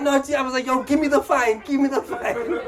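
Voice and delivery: laughing, throw up your hands and beg; exasperated plea. Retold or imitated.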